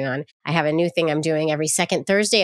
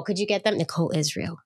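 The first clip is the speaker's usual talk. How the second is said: ordinary delivery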